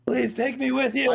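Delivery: high, very loud pleading; desperate scream. Acted. Stranded man begs the escaping group for a ride, screaming. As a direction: scream it out, begging not to be left. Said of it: "and he's screaming".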